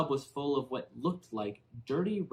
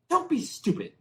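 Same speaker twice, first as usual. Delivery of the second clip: high loud shrill-voiced snap; high loud snapping aunt; snapped; 8 dB louder than usual. A narrator's reading. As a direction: bite his head off. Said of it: "snapped Aunt Petunia".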